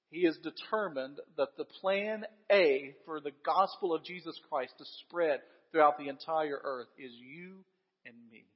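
ordinary delivery

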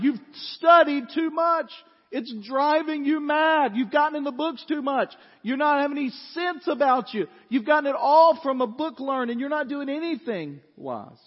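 high, loud and slow scolding; booming mocking rebuke; loud exclamation. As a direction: shout down the prisoner as a madman. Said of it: "Festus exclaimed in a loud voice".

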